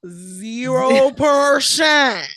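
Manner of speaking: put-on loud, slow Valley Girl quote; Valley Girl accent. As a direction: belt it with Valley Girl sass. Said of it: "this Valley Girl accent".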